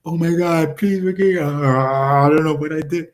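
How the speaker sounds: hammed-up tearful begging; mock weeping plea. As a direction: beg forgiveness in a put-on cry. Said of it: "weeping like".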